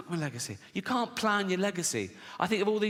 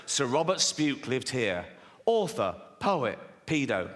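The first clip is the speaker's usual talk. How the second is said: slow, solemn and lower, a mock blue plaque; mock plaque reading; 5 semitones lower, slower than usual. Comedian reading a mock blue plaque, slow and solemn. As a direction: intone it like a dignified memorial.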